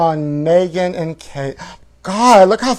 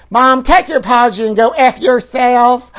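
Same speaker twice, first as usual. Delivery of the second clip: high, loud defiant retort; snappy comeback; 7 semitones higher, 6 dB louder than usual. Acted. Daughter shooting back at her mother, loud and defiant. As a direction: fire it back at mom. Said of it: "Britney shot back".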